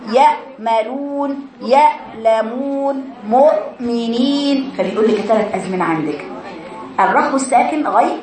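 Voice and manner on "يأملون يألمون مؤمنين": "يأملون، يألمون، مؤمنين" each with an abrupt hamza stop, then long vowel; hard stopped hamza. Shown as a demonstration. Teacher giving examples of hamza, sharp stop then madd. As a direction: stop dead on the hamza, then draw out the ending. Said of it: "وشديد ساكن زي الهمزة".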